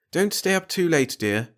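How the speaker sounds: a mother sighing happily over the couple; Marge's voice. Acted. A wife to her husband after the guests leave, fondly.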